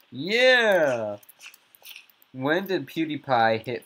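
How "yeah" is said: excited yeah. Real, not acted. Cheering correct answer.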